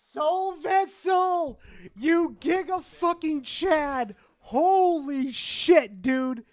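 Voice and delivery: loud, slow, high hype; drawn-out excited yell; hyped shout. Real, not acted. Reacting to big donation.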